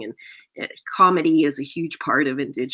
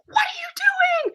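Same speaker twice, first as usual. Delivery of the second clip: very high, strained squeal; acted scandalized squeak; shocked protest. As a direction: squeal it in breathless disbelief. Acts shock at his lighting up.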